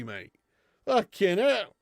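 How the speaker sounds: usual speaking voice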